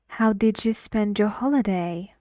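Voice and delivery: How did you spend your holiday, falling at holiday; falling intonation. Shown as a demonstration. Teacher models the falling question for students. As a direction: let the voice drop on holiday. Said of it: "Your voice drops".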